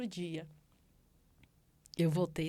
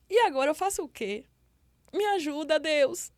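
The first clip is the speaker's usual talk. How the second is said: re-enacted cry to God, high and raised; tearful plea; 7 semitones higher, 4 dB louder than usual. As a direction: beg God for help, voice pitched high. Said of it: "eu comecei a chorar". (súplica chorosa e aguda)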